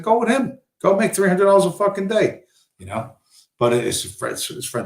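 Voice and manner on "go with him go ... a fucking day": high-pitched, fed-up comeback; exasperated retort. Retold or imitated. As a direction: toss it back at him, voice pitched way up. Acting out his fed-up retort.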